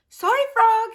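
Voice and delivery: high, loud apology to the frog; Frank apologising. A narrator's reading. Frank telling the frog his writing is secret, high and loud. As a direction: call out sorry, but firm. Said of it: "he says".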